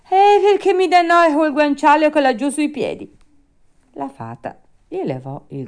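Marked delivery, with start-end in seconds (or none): 0.1-3.2 high, loud whining excuse; Pinocchio, peevish. Acted. Puppet makes excuses about the pillow, peevish. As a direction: complain shrilly like a difficult child.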